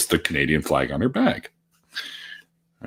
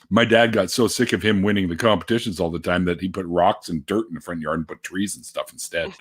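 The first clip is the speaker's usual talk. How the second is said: usual speaking voice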